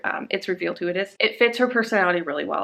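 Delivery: normal talking voice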